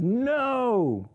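a stronghold's sharp high "No!" pushing back; defiant no. Retold or imitated. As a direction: refuse like an inner stronghold. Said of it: "the stronghold that rises up that says".

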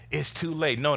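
ordinary talk

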